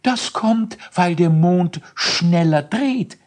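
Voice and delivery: high, slow explaining voice; lightly voiced explaining scholar. Elisius explains the moon's spin in a high voice. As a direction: explain it like a little professor. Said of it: "erklärte Elisius".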